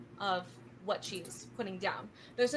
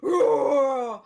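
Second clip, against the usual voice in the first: loud, drawn-out mock monster roar; 4 semitones higher, 13 dB louder than usual. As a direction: roar like a creature popping up. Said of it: "they pop up and go".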